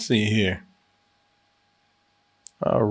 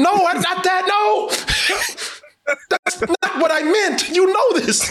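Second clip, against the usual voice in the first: much higher panicked protest, shouting; genie. Pretend. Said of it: "did Corey find a genie".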